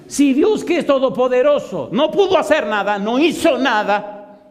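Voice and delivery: preacher exults about God's power, laughing shout; impassioned climax. Acted. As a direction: build to a near-shout, then drag out the final words.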